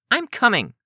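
high, stubborn insistence; stubborn girl refusing to back down. A narrator's reading. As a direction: dig in, pitched high.

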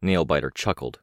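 ordinary delivery, nothing marked

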